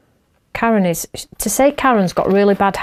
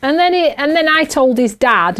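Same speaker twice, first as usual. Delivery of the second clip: ordinary delivery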